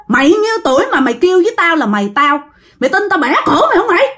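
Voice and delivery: heated, high-pitched retelling of a possessed growl; growling possessed man. Retold or imitated. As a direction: act out an angry ghost threatening an elder. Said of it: "nói với giọng đàn ông gầm gừ nghe ghê lắm".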